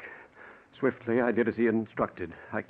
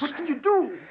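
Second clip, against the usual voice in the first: panicked; 3 dB louder than usual. Acted. Panicking at police arrival.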